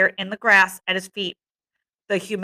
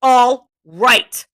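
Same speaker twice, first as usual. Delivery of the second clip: loud, higher, loaded threat; menacing; 4 semitones higher, 6 dB louder than usual. A narrator's reading. Jack holding the conch against Ralph, menacing. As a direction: make both words a warning. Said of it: "in tones of deep meaning and menace".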